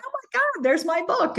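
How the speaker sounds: very high, thrilled squeal; freaking out. Retold or imitated. Seeing her book in a Johannesburg store, squealing high. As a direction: squeal with disbelief. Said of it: "I was, like, freaking out".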